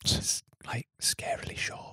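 hushed dramatic whisper. Pretend. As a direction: copy the jingle in a whisper. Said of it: "Why are we whispering?".

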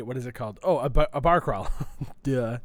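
ordinary talk

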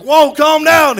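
hammed-up yelp telling him to calm down; retelling his own startled answer to the dad; mock alarmed reply. Himself reacting to dad.

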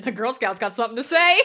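very loud imitated heckle; roasting taunt. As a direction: call her out loudly, teasing. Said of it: "just fucking roasted me".

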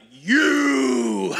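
a sudden, loud, high shout of "You"; imitating preacher's shout. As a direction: call him out like a street preacher. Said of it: "And he was like".

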